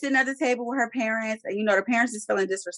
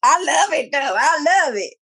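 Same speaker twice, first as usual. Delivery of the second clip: delighted; 5 semitones higher, 4 dB louder than usual. Real, not acted. Delighted reaction to gossip.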